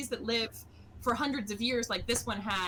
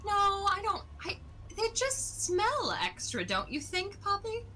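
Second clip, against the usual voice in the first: high, sweet, pleading character voice; 10 semitones higher than usual. Acted. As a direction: coo it up high, begging. GM voices tree-loving character.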